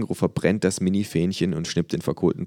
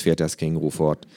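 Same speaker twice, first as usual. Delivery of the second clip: ordinary delivery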